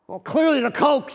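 put-on a cheeky child stating the obvious; imitating a cheeky little son. Son answers dad, exasperated.

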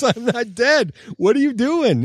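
high, indignant mock old lady voice; indignant old woman. Woman listed as deceased scolds the investor, high and indignant. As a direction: play the outraged lady who is very much alive. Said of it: "she calls me and she goes".

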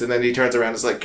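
usual speaking voice

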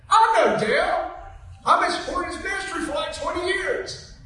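high, loud, eager quote; excited retold claim. Phil telling the speaker he knows Dale, excited retelling.

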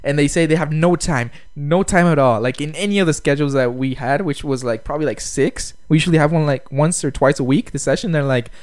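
ordinary delivery

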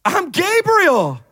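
high, slow, affronted announcement; Gabriel. Acted. Angel answering the doubting priest, high and affronted. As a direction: declare your name as if it should settle everything. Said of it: "this is what Gabriel says".

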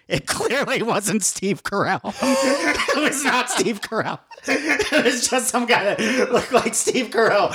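can barely get it out for laughing; cracking up at the wrong-Carell story; real laughter. Real, not acted. Laughing at own mix-up.

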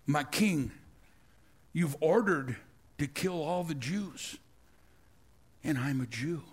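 low, soft, grave plea; hushed confession. Retold or imitated. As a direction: quietly reveal the secret.

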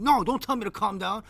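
high, loud mock indignation; imitated upset retort. Friend refusing to calm down, imitated as upset. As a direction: fire back as the worked-up friend. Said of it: "he's really upset".

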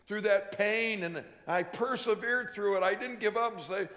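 ordinary talk

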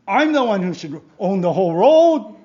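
loud, high, hammed-up indignation; entitled outrage. Driver who thinks the road is theirs, outraged. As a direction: protest like you own everything.

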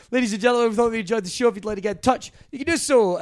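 host sign-off, lifted a little and brighter. Acted. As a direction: wrap up the episode for the listeners like a show host.

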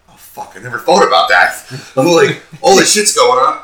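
high, loud startled outburst; retold shock at forgetting the ring; sudden realization. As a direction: caught off guard, blurting it out.

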